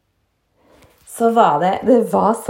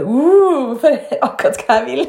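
imitated classmate's reaction; 3 semitones higher, 3 dB louder than usual. Classmate reacting to a cheeky remark, playfully mimicked. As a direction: mimic her with a big swooping ooh. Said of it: "Hun bare".

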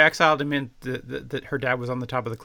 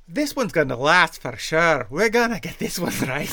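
louder, upbeat and sure; confident builder; 3 semitones higher, 8 dB louder than usual. Pretend. A Scottish builder cheers his crew on the bridge. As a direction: cheer on the crew, certain this time.

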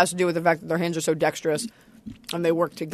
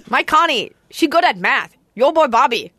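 put-on Laotian accent; 4 semitones higher than usual. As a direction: do the Laotian neighbor from King of the Hill. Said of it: "the Hank King of the Hill goes like".

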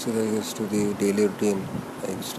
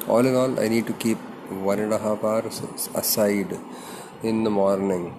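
usual speaking voice

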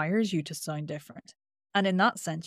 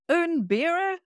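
tense Brit abroad attempting Spanish, pitched way up; nervous, high pitch; 11 semitones higher, 3 dB louder, slower than usual. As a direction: go high with nerves as you try out holiday Spanish. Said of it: "And they go, Hi, 'cause it's nerves".

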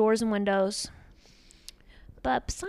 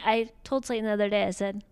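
normal talking voice

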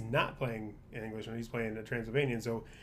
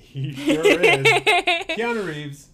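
high, loud and slow through a laugh; giddy fan laughter; laughing gush; 14 semitones higher, 12 dB louder, much slower than usual. Real, not acted. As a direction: swoon over him, laughing. Gushing over Keanu with a laugh.

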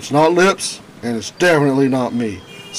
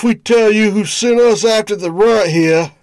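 gangster voice; 7 semitones higher, 4 dB louder than usual. Acted. Thug threatening the detective.

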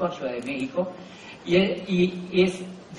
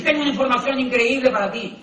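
higher, louder, overeager pitch voice; eager sales pitch; 7 semitones higher, 5 dB louder than usual. Retold or imitated. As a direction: sell it like a too-eager salesman. Imitating a cold call pitch. (entusiasmo exagerado de vendedor)

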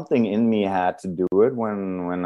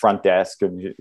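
usual speaking voice

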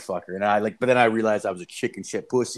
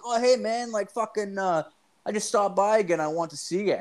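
high, breezy imitation of a pal; pitched-up, offhand greeting; imitated casual guest; 10 semitones higher than usual. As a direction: play the kid acting like nothing's odd. Voices the kid's casual breakfast reply.

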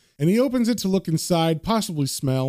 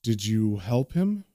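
low, slow, grave question; serious; 7 semitones lower, 4 dB quieter, slower than usual. A narrator's reading. Vicar questioning George about the burning man, serious. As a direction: ask it gravely and slowly. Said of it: "John and Rick get kind of serious".

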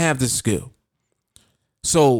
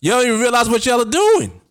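excited near-shout; 5 semitones higher than usual. Real, not acted. Hyping the trading group with an excited near-shout. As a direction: fire up the listeners with a near-shout.

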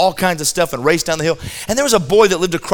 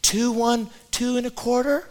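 a hint of a higher, dithering mom voice; mock mother stalling the count; 3 semitones higher, 9 dB quieter than usual. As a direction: spoof the mother who never reaches three. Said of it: "She would never get to three".

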